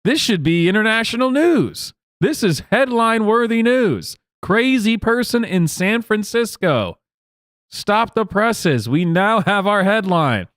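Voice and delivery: sarcastic newsreader; mock news anchor. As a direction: announce it like breaking news, in mock.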